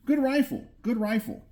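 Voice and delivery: ordinary talk, nothing marked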